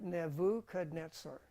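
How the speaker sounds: Nebuchadnezzar said slowly as Nevukadnetsar for the class to repeat; model pronunciation. Shown as a demonstration. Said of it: "Everybody say that".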